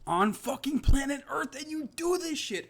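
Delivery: outraged shout at the promotion; furious shout. Real, not acted. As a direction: blast it at the UFC. Furious rant at the UFC.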